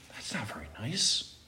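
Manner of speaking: soft, whispered mock complaint; whispered aside. Boy who never won at chess, whispered complaint. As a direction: slip it in under your breath.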